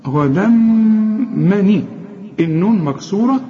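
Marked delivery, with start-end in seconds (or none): 0.1-1.8 very slow غدا مني, long hummed m, then a short i; merged with ghunna. Shown as a demonstration. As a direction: hold the nasal m between غدا and مني. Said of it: "إدغام بغنة".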